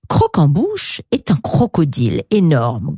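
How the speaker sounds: ordinary delivery, nothing marked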